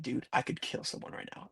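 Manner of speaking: under-the-breath whisper, voice gone; whispered threat. Retold or imitated. Whispering to Jason at the bottom of the stairs, seething. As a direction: hiss it so only he hears. Post-race rage told to friend.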